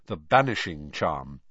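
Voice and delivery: ordinary delivery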